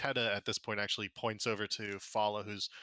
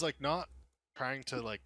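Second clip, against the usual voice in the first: ordinary delivery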